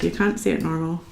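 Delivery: ordinary talk, nothing marked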